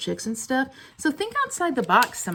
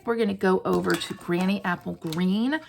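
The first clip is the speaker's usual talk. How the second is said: usual speaking voice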